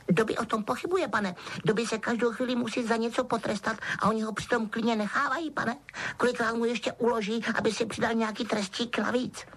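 high, deferential elf voice; squeaky little servant reciting his duty; Dobby, dutiful house-elf. A narrator's reading. Elf explaining whom he serves, dutiful.

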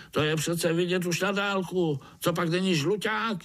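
high, insistent overseer voice; scornful overseer. A narrator's reading. Overseer points out the yellow ant's colour, scornful. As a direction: state the obvious in a high voice.

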